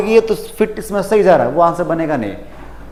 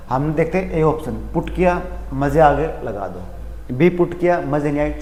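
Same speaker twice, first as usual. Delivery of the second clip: ordinary delivery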